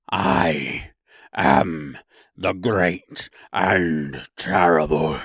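very slow, low monster voice; roaring beast. A narrator's reading. Beast announcing itself to the woodman, slow low roar. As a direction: draw it out like a huge, terrible beast. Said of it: "in a voice that was one great roar".

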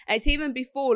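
ordinary talk

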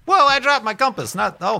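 sudden high, loud whoa; startled. Real, not acted. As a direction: jump at the dropped compass. Drops compass on stream.

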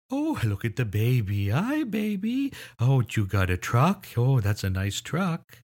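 high, slow, sing-song cooing; baby-directed speech. Shown as a demonstration. Adult admiring a baby and its truck, exaggerated sing-song baby talk. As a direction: stretch the vowels and swoop the pitch for a baby. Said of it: "we do talk like that to babies".

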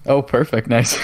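ordinary talk, nothing marked

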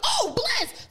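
high-pitched thankful exclamation; delighted relief. Retold or imitated. Friend learns they are getting into the show, delighted relief. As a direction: let relief lift the voice high.

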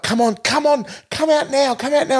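high-pitched frantic urging; mock panic. Cops urge trapped colleague out, mock panic. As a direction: imitate panicked cops, pitch shooting right up.

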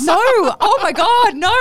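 slow, high, loud oh my God; stunned high exclaim; shocked. Real, not acted. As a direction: drag it out in disbelief. Shocked friend doesn't know.

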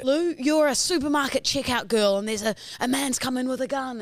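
hammed-up bossy acting coach giving exercises; drama teacher. Imagined drama teacher directing the class, mock bossy. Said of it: "we were gonna arrive and be like".

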